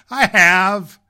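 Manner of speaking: loud, high protest; cried out. A narrator's reading. Little rabbit crying out to the mocking wild rabbit. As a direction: cry it out in defiance. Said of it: "cried the little rabbit".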